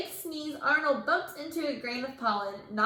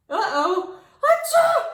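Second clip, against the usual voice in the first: loud sneeze; 13 semitones higher, 9 dB louder, slower than usual. A narrator's reading. Sneeze in the story.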